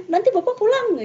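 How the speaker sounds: high mock snap at a child; a mother's threat, lightly acted, pitched high; snapping mother. Mother snaps at her little daughter, mock high threat. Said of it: "dibentak oleh ibunya".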